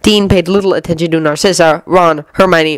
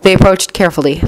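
ordinary delivery

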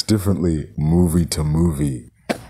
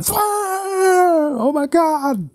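panicked scream. Retold or imitated. Kid caught by Michael Myers, screaming in panic. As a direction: yell it like a kid waking up trapped.

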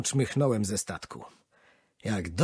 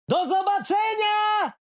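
much higher, slow full-voiced shout; shouting farewell from the moving train; shouting goodbye; 5 dB louder, slower than usual. A narrator's reading. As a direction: yell it from the train door. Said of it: "krzyczałem na cały głos".

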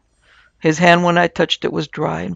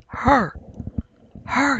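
higher, peevish repetition; 8 semitones higher, 3 dB quieter than usual. A narrator's reading. Feverish patient answering his friend peevishly. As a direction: snap it back, irritated and petulant. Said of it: "he answered peevishly".

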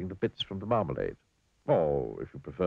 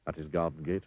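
usual speaking voice

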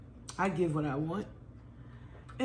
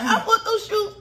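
much higher, louder playful whine, laughing; mock-covet the shoes like a kid; wanting her shoes; 14 semitones higher, 6 dB louder than usual. Mother imitating herself eyeing daughter's shoes, high laughing whine. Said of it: "I be like".

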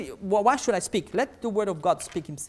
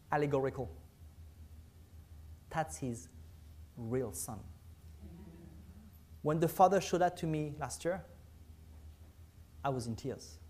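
hushed confession; 5 semitones lower, 13 dB quieter than usual. Real, not acted.

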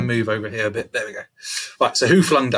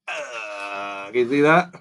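ordinary delivery

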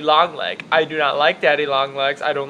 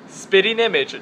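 ordinary delivery

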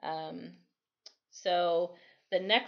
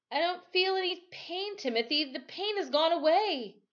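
put-on boy's voice; 11 semitones higher than usual. Boy tells Timothy.

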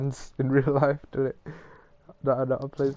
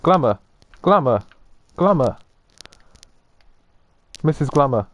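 loud calling; 8 dB louder than usual. Real, not acted. Calling a player's name.

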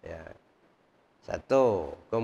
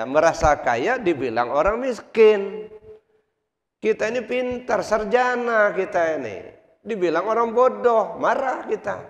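higher and louder, driving each point home; fiery preaching; 7 semitones higher, 5 dB louder than usual. Acted. Preacher rebukes the listeners about anger, at full force. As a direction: hammer the lesson at the congregation.

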